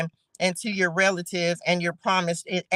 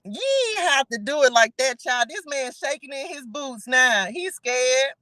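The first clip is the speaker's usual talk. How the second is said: ordinary delivery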